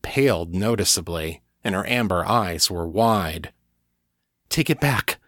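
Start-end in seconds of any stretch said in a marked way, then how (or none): 4.5-5.2 higher, pressing plea; a touch of urgency in Kate's voice; urgent plea. A narrator's reading. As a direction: push it out urgently. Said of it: "she said urgently".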